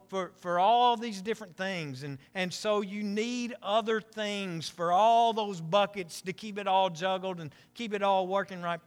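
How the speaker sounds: usual speaking voice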